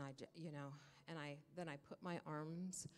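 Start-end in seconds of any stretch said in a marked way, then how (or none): none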